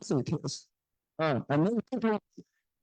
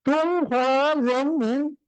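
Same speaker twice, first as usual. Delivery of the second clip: put-on Mao Zedong's Hunan accent; 10 semitones higher, 3 dB louder than usual. Lecturer imitating Mao proclaiming the republic, strong Hunan accent. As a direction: declaim it like Mao in heavy Hunan-accented Mandarin. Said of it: "I imitate it as a Mao Zedong's accent".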